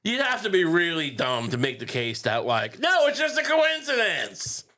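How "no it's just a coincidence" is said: high, drawn-out mocking denial; mock protest. Mimicking someone making a dumb case, mock protest. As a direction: protest slowly in a much higher voice.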